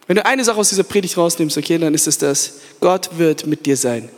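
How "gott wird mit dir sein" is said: ordinary talk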